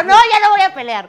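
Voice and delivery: hammed-up high yell of giving up; mock shout. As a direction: blurt it out high and loud. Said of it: "y yo".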